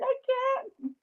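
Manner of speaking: very high, tearful retold panic; squeaky, near-crying protest; panicked wail. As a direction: wail it like a re-enacted meltdown. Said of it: "I'm panicking".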